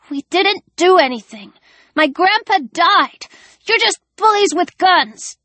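put-on high, upset child's voice; a flushed girl lashing out at bullies; young girl's voice. A narrator's reading. Girl protests to the armed men, high and upset. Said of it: "Ellie's cheeks were flushed".

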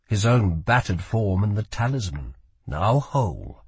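ordinary delivery, nothing marked

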